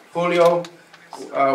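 ordinary delivery

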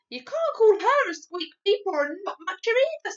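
high, loud, indignant insistence; angrier and angrier. A narrator's reading. Sophie defending the Queen, increasingly angry. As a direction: scold with rising heat. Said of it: "getting angrier and angrier".

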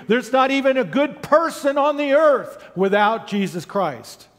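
high, forceful pulpit delivery; rising sermon climax, key words punched; emphatic preaching. Real, not acted. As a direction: drive each point home like a preacher.

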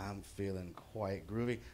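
put-on slow, low drunken drawl; pretend-drunk, stretched-out low reply; acted drunk. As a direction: play it tipsy, deep and unhurried. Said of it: "Remember, I'm drunk as hell".

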